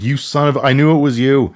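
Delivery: half-hearted furious vendor yelling; hot dog vendor yelling at the man who fouled his sink, mock angry. Hot dog vendor catching the culprit.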